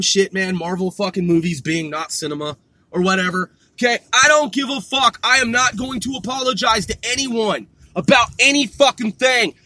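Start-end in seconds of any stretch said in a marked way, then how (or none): none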